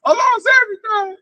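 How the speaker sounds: high wailing mock sob; mock crying. Ruined rich man crying at home, a high mock wail. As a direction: wail like you lost all your money. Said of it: "You gonna hear crying".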